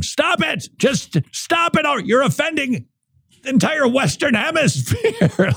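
high falsetto mock outrage; mock yelling. Host voicing annoyed listeners in high mock squeal. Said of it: "several people wrote in yelling".

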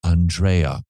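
"Andrea" said as ahn-DRAY-uh; ahn-DRAY-a pronunciation. Shown as a demonstration. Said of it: "Or is it Andrea?".

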